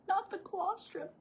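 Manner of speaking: laughing. Real, not acted. Gagging, laughing.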